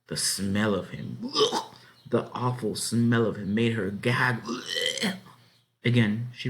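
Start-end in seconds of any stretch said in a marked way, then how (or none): 1.2-1.7 high-pitched ugh of disgust. A narrator's reading. Girl gags at the pig's awful smell, disgusted ugh. 4.5-5.1 high-pitched ugh of disgust. A narrator's reading. Girl gags at the pig's awful smell, disgusted ugh.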